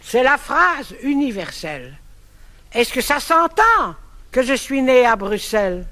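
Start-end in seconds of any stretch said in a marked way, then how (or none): none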